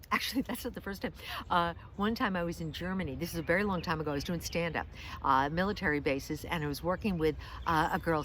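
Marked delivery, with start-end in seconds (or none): none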